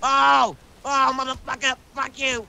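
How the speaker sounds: loud high outburst of pain; pained yell. Acted. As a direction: yell out as if hit hard. Attacked, yells in pain.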